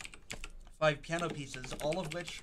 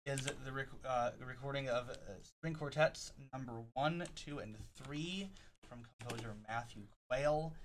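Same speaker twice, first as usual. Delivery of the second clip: normal talking voice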